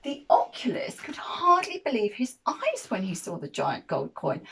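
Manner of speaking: plain reading voice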